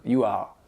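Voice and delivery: "you are" low, "are" open with no French R; softened final R. Shown as a demonstration. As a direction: let "are" end softly without a throaty R. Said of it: "ça vous évite de faire R".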